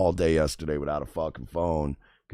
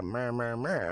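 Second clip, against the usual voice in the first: higher, softer mocking meh-meh; mocking blah-blah; 4 semitones higher, 5 dB quieter, slower than usual. Imitating the Uber driver brushing him off, mocking. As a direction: brush it off in a mock whiny drone.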